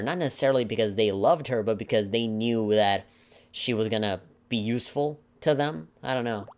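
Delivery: ordinary talk, nothing marked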